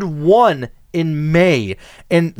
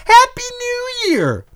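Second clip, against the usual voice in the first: put-on much higher, slow baby voice; imitating a toddler; slower than usual. Host quoting baby Archie's first words, high toddler voice. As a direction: squeak it like a tiny child.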